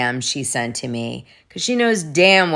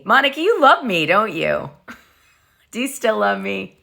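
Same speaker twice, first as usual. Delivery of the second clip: teasing; 5 semitones higher than usual. Real, not acted. Teasing Monica directly.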